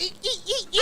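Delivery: high squealing eeks; mouse squeak. Pretend. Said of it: "I'd be like".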